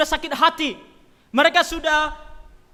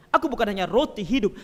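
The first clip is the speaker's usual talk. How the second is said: usual speaking voice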